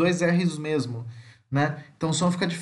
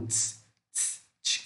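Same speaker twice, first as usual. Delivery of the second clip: isolated soft unvoiced "tss" bursts; short voiceless tss demonstrated by itself; affricate sound demo; 9 dB quieter, slower than usual. Shown as a demonstration. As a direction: make a quiet t-plus-s friction noise, repeated. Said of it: "Aquele símbolo que eu falei".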